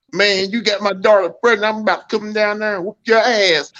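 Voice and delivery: imitating an irate caller; mock angry threat. Prank caller threatens in retold call.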